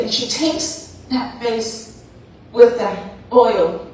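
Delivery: ordinary delivery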